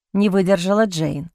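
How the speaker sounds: plain reading voice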